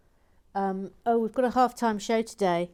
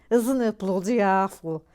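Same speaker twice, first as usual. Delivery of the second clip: lightly done Scouse nan, louder; Scouse old lady voice, a touch hammed-up; Scouse accent; 8 dB louder than usual. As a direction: imitate a Liverpool grandmother in a Scouse accent. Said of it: "cue Scouse accent".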